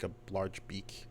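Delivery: ordinary delivery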